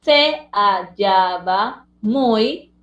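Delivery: "Se hallaba muy" slow, se, ha, lla, ba, muy; syllable by syllable. Shown as a demonstration. Teacher correcting the split, "Se hallaba muy" in slow separate syllables. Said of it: "muy debía estar junto".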